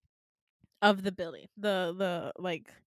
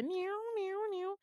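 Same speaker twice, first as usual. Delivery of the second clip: high, soft siren wail; a softer mock alarm noise; imitated alarm; 10 semitones higher, 7 dB quieter than usual. Imitating the alarm flashing in the dark hospital, soft wail. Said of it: "an alarm system going off".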